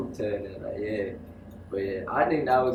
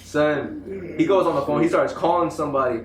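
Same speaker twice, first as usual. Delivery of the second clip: ordinary delivery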